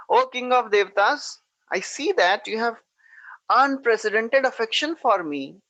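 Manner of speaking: a god mocking his guest with sweet words; sarcastic. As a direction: praise him with an edge of sarcasm. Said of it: "In a sarcastic voice".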